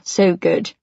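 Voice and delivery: ordinary delivery, nothing marked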